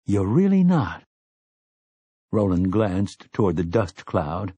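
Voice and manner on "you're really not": higher-pitched, incredulous question; disbelief. A narrator's reading. Eddie to Roland, who won't go after them, mounting disbelief. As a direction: let the pitch leap in disbelief. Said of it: "looked at Roland with mounting disbelief".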